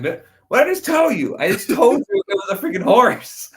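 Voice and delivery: high, loud exasperated mock outburst; retold indignant squawk; exasperated yell. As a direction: act out someone fed up, voice shooting way up. Annoyed character yelling in retold scene.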